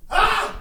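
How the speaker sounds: short high-pitched yelp; abrupt high "ah!" imitating mom; mock scream. Mom leaning down to test the dog, short high mock scream.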